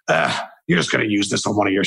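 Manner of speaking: low, fast put-on grandma voice; mock the grandma in a gruff low voice; odd grandma voice. Grandma to her grandson, in a low, fast put-on voice. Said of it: "I don't know why I use that voice".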